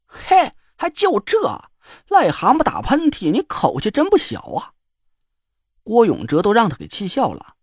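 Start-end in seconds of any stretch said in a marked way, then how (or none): 0.1-4.7 slow, high, drawn-out 还就这 in mock disbelief; mocking joker. A narrator's reading. Joker scoffing at the girl's boast: mock disbelief. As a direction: scoff at her bravado.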